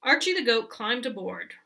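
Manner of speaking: ordinary delivery, nothing marked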